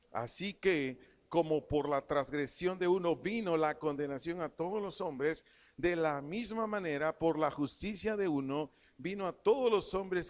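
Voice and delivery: preacher proclaims the verse, leaning on each phrase; scripture reading. Acted. Preacher reads Romans to the church, emphatic scripture reading.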